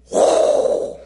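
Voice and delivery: long, loud imitated blast of wind; tornado whoosh. Preacher imitating the wind that sucked him out, loud whoosh. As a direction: blow a drawn-out tornado roar. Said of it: "You know how a tornado sounds".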